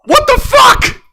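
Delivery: high, loud disbelieving yell; shocked outburst. Real, not acted. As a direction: shriek it in disbelief. Outraged reaction.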